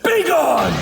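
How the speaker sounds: high, explosive banishing yell; furious shout. Acted. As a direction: blast them away with your voice. Villain drives foe away.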